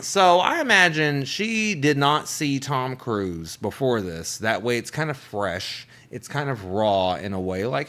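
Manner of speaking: ordinary talk, nothing marked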